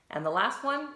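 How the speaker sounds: ordinary talk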